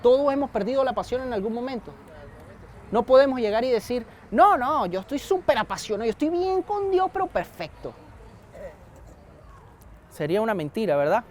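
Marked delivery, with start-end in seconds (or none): 4.3-7.9 mock self-righteous boast. Preacher mocking a churchgoer who claims perfect passion, put-on smug voice. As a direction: parody the smug believer who says all is fine.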